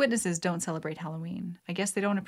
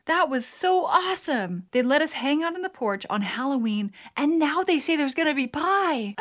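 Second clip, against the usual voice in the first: excited pumpkin; 7 semitones higher than usual. Acted. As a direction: gush like a happy pumpkin. Pumpkin voiced in a joke.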